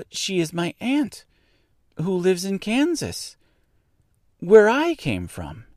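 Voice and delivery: put-on high-pitched girl's voice; earnest little girl. A narrator's reading. Dorothy explaining who her aunt is, earnest.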